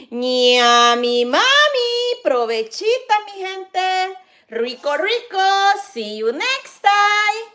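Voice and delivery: loud, high cheerful goodbye; loud, high, slow sing-song sign-off; excited sign-off. Real, not acted. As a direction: sing out the farewell to viewers.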